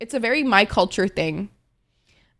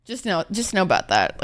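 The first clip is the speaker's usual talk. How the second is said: usual speaking voice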